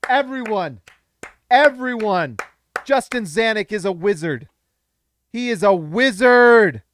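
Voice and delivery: thrilled praise; excited proclaiming. Real, not acted. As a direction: call it out to the crowd.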